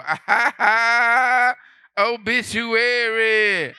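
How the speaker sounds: slow, high, loud cackling obituary; mock laugh. Mourner laughing at the obituary, high and loud. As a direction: cackle it out slowly. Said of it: "You gonna go".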